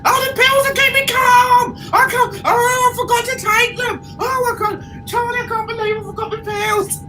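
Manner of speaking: high, tearful, rushed panic; panicked babble. Retold or imitated. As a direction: gabble it near tears. Panicking to the crew.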